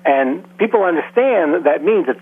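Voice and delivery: ordinary talk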